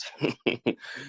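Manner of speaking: quiet run of laughter; soft chuckle. Real, not acted. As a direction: chuckle softly to yourself. Laughs at imagined future price.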